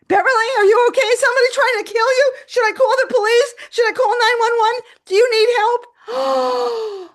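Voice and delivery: high, loud volley of alarmed questions; panicked doctor. Retold or imitated. Doctor on the phone hearing screams, panicked shouting.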